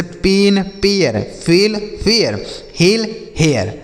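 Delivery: paired drill, plain vowel in पेन, glide in पेयर; one-syllable फेल against two-part फेयर with "-yer"; measured teacher demo, हेल then gliding हेयर; contrasting vowel sounds. Shown as a demonstration. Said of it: "ऐसे पढ़ेंगे".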